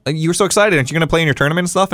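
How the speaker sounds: high, quick motherly question; mom asking. Retold or imitated. Mom questioning her son about the tournament, high and quick. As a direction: rattle it off like a surprised mom.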